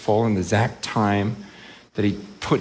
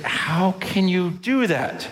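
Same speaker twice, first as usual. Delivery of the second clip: slow, higher-pitched disbelief; incredulous appeal; 8 semitones higher, slower than usual. Acted. Preacher confronting the casual hearer, incredulous. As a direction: ask it slowly, appalled.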